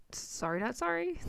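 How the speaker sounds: cheeky. Real, not acted. Cheeky apology for cussing.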